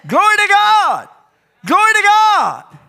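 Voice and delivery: loud, very high cry of praise; exultant praise shout. Shown as a demonstration. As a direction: shout it out to the rafters, high and sustained. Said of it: "out of your spiritual clothes and shout".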